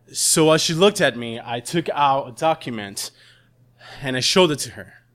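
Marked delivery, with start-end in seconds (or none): none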